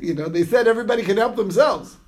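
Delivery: snide mock complaint through laughter; sarcastic with a laugh. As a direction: laugh it off bitterly, as the put-upon owner. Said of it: "it's all in the tone".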